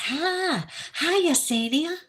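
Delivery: high, bright hello; bright greeting. Real, not acted. Welcoming a new viewer with a bright high hello. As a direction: greet her sweetly and high.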